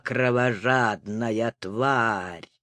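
soft, slow and low menace; low hypnotic murmur. Acted. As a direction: murmur the insult softly and slowly. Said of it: "стал негромко повторять".

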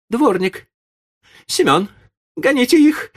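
very high, loud lady's call; furious cry. A narrator's reading. Enraged lady calling the yard-keeper, furious. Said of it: "закричала с искажённым от гнева лицом барыня".

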